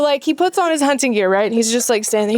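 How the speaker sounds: ordinary talk, nothing marked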